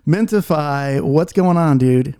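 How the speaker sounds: hype callout. Real, not acted. Podcast host calling in Mintify for the update, loud hype greeting. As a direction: call him in like a hype man.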